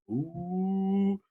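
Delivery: high, soft teasing ooh; a breathy high mocking ooh from the side; Uso ooh. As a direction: sidelines ooh like the Usos. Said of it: "as typical Uso fashion".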